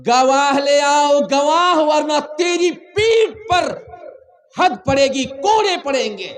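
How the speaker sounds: high, forceful shouted warning; stern loud demand. Retold or imitated. Judge warning the accuser to bring witnesses, stern shout. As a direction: hammer out the demand at full voice.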